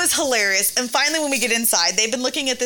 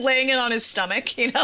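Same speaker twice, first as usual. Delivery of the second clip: ordinary delivery, nothing marked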